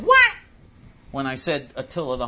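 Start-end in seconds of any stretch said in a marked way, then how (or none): 0.0-0.3 "What?" squeaked much higher and louder; a shrill mock of her baffled reply; sneering imitation of the supervisor. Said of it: "She said".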